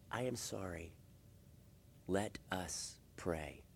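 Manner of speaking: very soft, low and slow; measured, tender words with space between them; humble apology. Acted. Spouse apologizing and inviting the other to pray, gently. Models apology and call to prayer.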